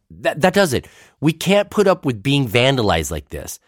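defiant. Retold or imitated. Pastor to setup guys, fed up with stolen signs, defiant. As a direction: put your foot down, indignant. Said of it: "I defiantly told them".

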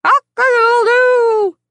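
high loud imitated crow; rooster crowing. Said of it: "crowing all the way".

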